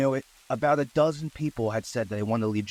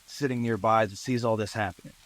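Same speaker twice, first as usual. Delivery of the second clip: ordinary delivery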